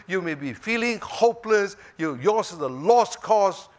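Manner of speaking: normal talking voice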